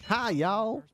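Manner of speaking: mock Texas twang; Texas accent. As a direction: put on a Texan greeting. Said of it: "His accent sounds somewhere between Dallas and Austin".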